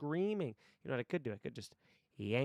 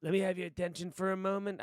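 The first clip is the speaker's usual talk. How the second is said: higher, louder, brisk speech opening; monologue voice; 5 semitones higher, 6 dB louder, faster than usual. Acted. Salesmen's boss demanding attention for a speech, commanding monologue voice. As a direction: call the room to order in a put-on voice. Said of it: "I don't need to do the voice".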